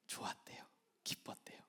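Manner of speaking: hushed whisper; whispered contentment. Acted. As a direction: breathe it out softly, savoring relief. Preacher whispers Jonah's contentment.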